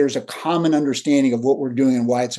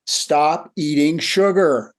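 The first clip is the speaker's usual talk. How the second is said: slow firm order; much slower than usual. Retold or imitated. Gandhi tells the son.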